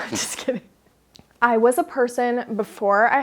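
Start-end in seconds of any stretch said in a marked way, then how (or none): 0.0-0.6 breathy laugh through "Just kidding". Real, not acted. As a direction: giggle it off, almost whispered. Laughs off her threat.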